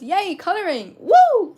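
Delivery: rising into a loud, high whoop on Woo; excited cheer. Retold or imitated. Reading a viewer's cheering message.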